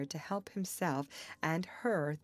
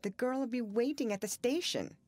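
higher, worried protest; anxious reminder, pitched up; faltering; 6 semitones higher than usual. A narrator's reading. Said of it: "she faltered".

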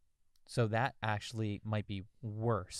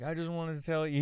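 higher-pitched, lightly acted drunk; acted tipsy confiding; 7 semitones higher, 3 dB louder than usual. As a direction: play a mild drunken confession. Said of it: "He's drunk".

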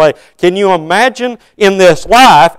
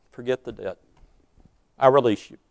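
much softer, lower, gentle forgiveness; tender release; 6 semitones lower, 11 dB quieter, faster than usual. Acted. Lord releasing the slave from his debt, soft and tender. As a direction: let him go softly, almost a hush.

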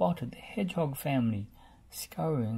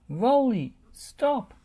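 higher, louder stop call; urgent shout; 10 semitones higher, 6 dB louder, slower than usual. A narrator's reading. Bob shouts at Rolly to stop. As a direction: shout him to a halt. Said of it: "cried Bob".

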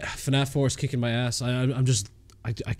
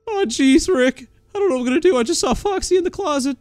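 high, loud mock-panicked voice; panicky sidekick impression; 11 semitones higher, 4 dB louder, faster than usual. Sidekick telling Rick that Foxy is in the closet, mock panic. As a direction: whine frantically in a put-on high voice.

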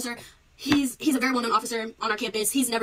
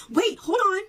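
sudden urgent interjection; 10 semitones higher, 5 dB louder, slower than usual. Retold or imitated. Professor voiced in retold arrest.

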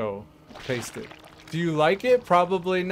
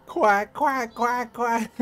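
high squawked quack quack; duck quacks; 8 semitones higher, 3 dB louder, slower than usual. Pretend. Watching an opponent turned into a duck, high mock quacking.

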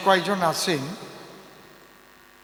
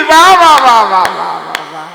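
delighted exclaim; 11 dB louder than usual. Real, not acted. Singer praising his own dialect word, loud and delighted. Cheering himself after the word.

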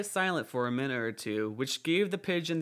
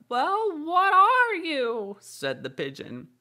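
very high, louder, slow probing bird voice; suspicious pigeon; 6 dB louder than usual. A narrator's reading. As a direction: needle her like a distrustful pigeon.

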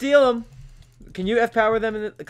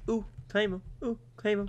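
high voice; 9 semitones higher than usual. Retold or imitated. Copies friend in high voice.

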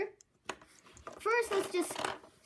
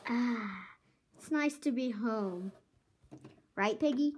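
ordinary talk, nothing marked

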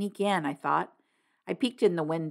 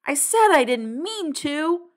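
frustrated insistence; 9 semitones higher, 4 dB louder, slower than usual. A narrator's reading. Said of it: "tears of anger and frustration".

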